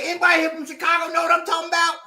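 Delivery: fast, high mock shout; retold high-pitched call-out; calling for backup, imitated. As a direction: appeal to the whole bus in a rush. Acts man appealing to bus riders.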